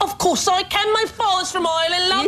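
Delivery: high-pitched, hammed-up London accent. Shown as a demonstration. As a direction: put on a comically bad London accent. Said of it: "Can you do a London accent?".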